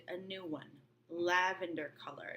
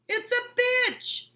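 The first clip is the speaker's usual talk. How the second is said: much higher, louder and slow, dead certain; decisive Tom; 11 dB louder, much slower than usual. Acted. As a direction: plant the word like a verdict. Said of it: "said Tom decisively".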